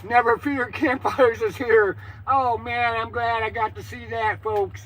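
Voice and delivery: booming slow show host call-out; loud announcer voice. Acted. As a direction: announce it big to the audience. Announces to viewers after blast.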